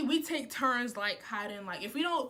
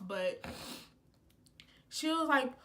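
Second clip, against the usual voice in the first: ordinary talk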